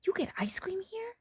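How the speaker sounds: high hushed whisper. A narrator's reading. Brother whispering amazed about hospital ice cream. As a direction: whisper the question in awe. Brother's line read aloud.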